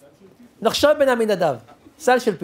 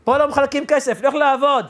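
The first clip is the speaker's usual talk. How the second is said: loud, higher annoyed homeowner brushing him off; gruff father; 7 semitones higher, 5 dB louder than usual. Retold or imitated. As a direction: snap at the stranger at the door. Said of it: "הוא אומר לי".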